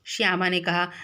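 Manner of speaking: ordinary talk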